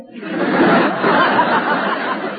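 breathy laugh; husky laugh. Real, not acted. Tallulah laughs at Judy's joke. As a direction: laugh at the gag.

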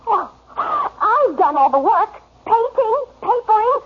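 high-pitched, emphatic outburst; angry berating. Acted. Wife berates husband over the housework, angry. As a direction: lay into him, word by word.

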